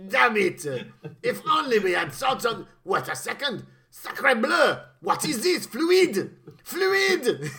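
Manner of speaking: loud, hammed-up French accent. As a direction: exclaim like a comic Frenchman. French henchman exclaiming, Sacre bleu.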